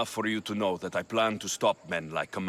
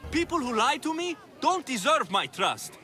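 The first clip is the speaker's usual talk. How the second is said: higher, lightly put-on voice of a loyal follower; pretend Cleon supporter; 13 semitones higher than usual. Posing as Cleon's supporter to help Socrates, higher and lightly put-on. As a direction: play the naive crowd member defending Cleon. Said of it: "respond as if you're one of Cleon's supporters".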